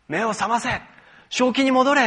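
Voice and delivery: 更高更响的强硬命令; 像严厉地喝醒对方; strong commanding words. Retold or imitated. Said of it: "そういう強い言葉で".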